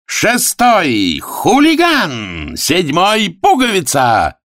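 high, slow ringing call; race announcer. Acted. Arthur announces the last runners, high drawn-out call. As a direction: draw out each name high.

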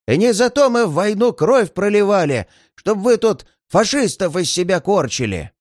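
put-on high, trembling old veteran's scolding; quavering elderly voice, indignant; shaky old voice. A narrator's reading. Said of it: "трясущийся старческий голос".

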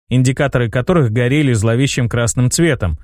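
plain reading voice

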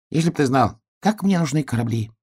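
high little hedgehog voice, wistful; longing hedgehog. Acted. Hedgehog tells bear cub his need, high and longing.

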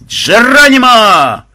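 very loud, high, long-drawn yell; full-throated war whoop; battle cry. A narrator's reading. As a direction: holler it as if jumping from a height. Battle cry from someone falling.

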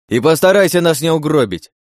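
loud, high exasperated warning; urgent shout. A narrator's reading. Driver warns princess now steering the car, shouting urgently. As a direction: shout it over the racing engine.

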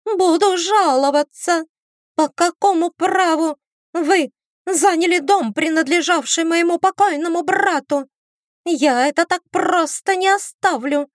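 high, shrill hysterical complaint; hysterical woman. Acted. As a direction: screech it like an outraged relative. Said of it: "высокий истеричный женский голос".

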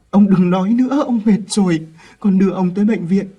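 loud, high, urgent begging; frantic pleading. Acted. Boy begging his dying grandfather to let him help, frantic. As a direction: beg him to hold on.

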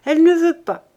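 put-on a boy pushes the words out roughly; hoarse boy. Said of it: "d'une voix enrouée".

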